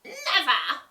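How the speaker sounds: high, loud cackled refusal; cackling witch. A narrator's reading. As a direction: cackle the word out. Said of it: "cackled the witch".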